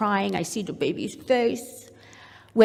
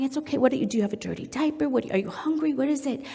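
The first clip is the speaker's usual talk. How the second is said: put-on soft, higher-pitched coaxing of a baby; attuned, soothing questioning; 4 semitones higher, 3 dB quieter, much faster than usual. Mom to still-crying baby, gently searching. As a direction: gently fuss over what the baby needs. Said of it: "I'm assessing and attuning".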